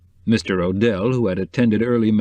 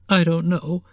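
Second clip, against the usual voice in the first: mild wail, much higher; a touch of a tearful cry; 8 semitones higher than usual. Acted. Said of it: "she wailed".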